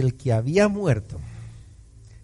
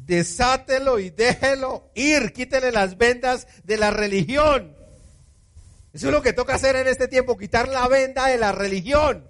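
higher, driving preacher's cadence; fervent preaching; 8 semitones higher than usual. Acted. Pastor urges the church to drop religion, fervent preaching. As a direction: preach it out with fire.

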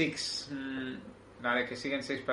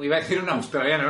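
ordinary talk, nothing marked

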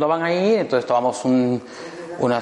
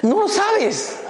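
laughing punchline; much slower than usual. Real, not acted. Punchline to worried lifeguard.

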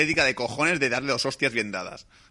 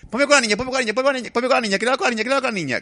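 much higher, louder, frantic mock pleading; hysterical wife; 13 semitones higher, 4 dB louder, faster than usual. Wife on the phone demanding the daughter, mock hysterical. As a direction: beg over and over, getting faster. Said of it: "la mujer se pone en plan histérica".

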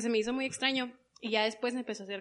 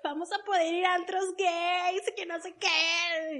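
much higher, thrilled squeal of a sister; excited sister; 8 semitones higher, 5 dB louder, slower than usual. Retold or imitated. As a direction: gush with excitement about the clubs.

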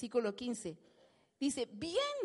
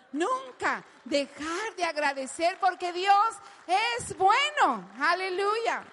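louder, higher rising proclamation; building praise; 3 semitones higher, 6 dB louder than usual. Real, not acted. Preacher urges the congregation to never stop thanking, loud.

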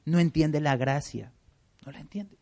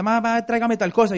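put-on child asking mom; 6 semitones higher, 4 dB louder than usual. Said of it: "lo ha mandado a la tienda".

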